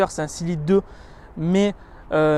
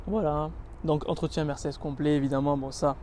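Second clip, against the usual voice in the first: ordinary talk, nothing marked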